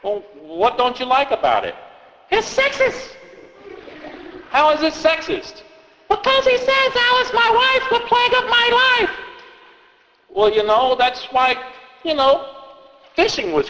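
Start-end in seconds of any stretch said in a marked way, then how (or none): 2.3-9.1 put-on high kid voice quoting the story back; a nitpicking little kid; child voice. Child complaining to the reader in a high kid voice.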